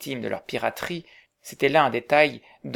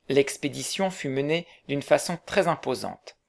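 plain reading voice

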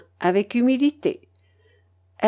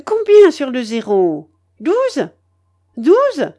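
demanding grandmother; 4 semitones higher, 7 dB louder, slower than usual. A narrator's reading.

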